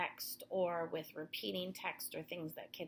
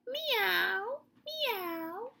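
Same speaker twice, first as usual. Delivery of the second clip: high, louder meow; cat meow; 12 semitones higher, 5 dB louder than usual. Acted. Reading to children, high cat meow.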